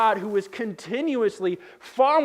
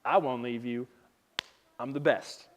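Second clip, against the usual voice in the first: mock solemn boast; 7 semitones lower, 5 dB quieter than usual. Peter boasting of his loyalty.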